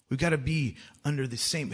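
normal talking voice

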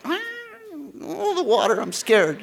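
high-pitched mock fright; hammed-up scared wail; scared child. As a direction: whimper like a kid afraid of the water. Imitating scared child clinging in pool.

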